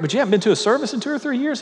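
can't believe what he's hearing, quick and high; incredulous question. Retold or imitated. Pastor to the no-show teacher, high rushed disbelief.

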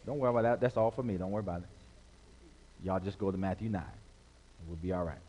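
ordinary delivery

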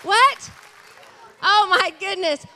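loud, high, drawn-out exclamation; amazed excited shout. Real, not acted. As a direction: call it out to the crowd, thrilled and amazed. Amazed at visitor from far away.

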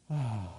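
long breathy release of relief; sigh of relief. Shown as a demonstration. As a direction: let peace flood out in a sigh. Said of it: "and you go".

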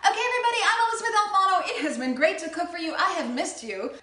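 high, loud cheerful farewell; upbeat sign-off. Real, not acted.

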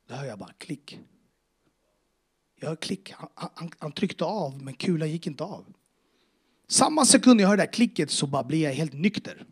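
ordinary talk

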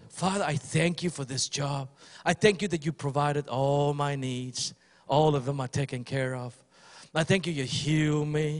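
low, calm, assured "I thank you"; settled thanksgiving, softly spoken; thankful right prayer. Shown as a demonstration. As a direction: model a confident grateful prayer. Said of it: "The way you pray is".

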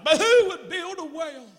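high, loud rhetorical preaching; mock fervent preacher; brother preaching. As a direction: pose it like a pulpit challenge. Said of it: "He started preaching about".

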